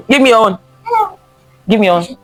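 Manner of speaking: ordinary talk, nothing marked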